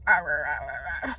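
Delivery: low mock monster growl; imitated sick monster babble; monster-like lost voice. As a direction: growl out wordless rahs like a failing voice. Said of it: "a monster or something".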